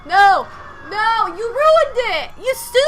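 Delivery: loud, high, outraged no; frustrated shout. Real, not acted. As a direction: shout at the game in disbelief. Game goes wrong mid-play.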